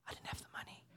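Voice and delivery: a hushed confession in a whisper; whispered aside. Real, not acted. Admitting to the congregation he had no money, whispered. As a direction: whisper it like a guilty secret.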